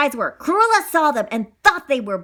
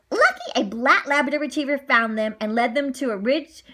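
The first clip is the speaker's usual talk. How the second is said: ordinary delivery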